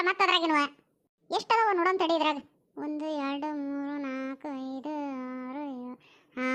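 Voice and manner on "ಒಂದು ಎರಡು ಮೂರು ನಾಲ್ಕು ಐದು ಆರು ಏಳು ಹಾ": low, very soft murmured count; softly tallying items, half to yourself; counting to oneself. Acted. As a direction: count under your breath.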